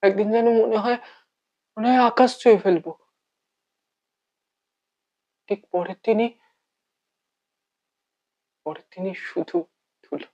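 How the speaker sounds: hushed, halting monologue with long pauses; heartbroken lover. Acted. Bereft lover speaking to the one who is gone, heartbroken.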